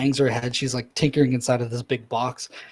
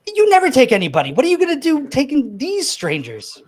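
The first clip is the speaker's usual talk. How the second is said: high, loud and fast indignant outburst; agitated accusation; 12 semitones higher, 7 dB louder than usual. Acted. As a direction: jump on him with a shrill-pitched demand, rushing. Little eel confronts Orsov.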